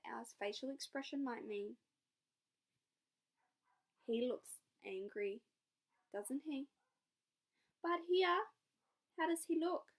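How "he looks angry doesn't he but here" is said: normal talking voice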